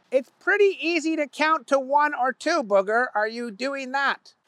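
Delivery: high, pointed questioning boss voice; cross-examine the guilty worker; stern boss. A narrator's reading. Owner questions Booger.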